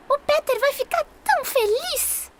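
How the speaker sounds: high fairy voice, full of glee; excited fairy. Acted. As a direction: chirp it like a thrilled little fairy. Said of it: "Sininho gritou".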